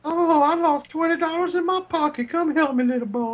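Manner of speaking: high creepy lure voice. Retold or imitated. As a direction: coax kids with a creepy high voice. Voicing a creepy stranger.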